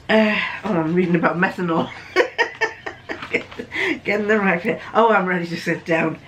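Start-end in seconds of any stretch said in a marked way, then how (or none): 2.1-4.0 real breathy, voiceless laugh. Real, not acted. Reader amused mid-reading, laughing. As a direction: laugh to yourself, almost without voice.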